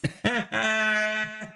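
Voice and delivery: sudden real laugh; burst of laughter. Real, not acted. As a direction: laugh out at the joke. Laughing at mocking Kevin.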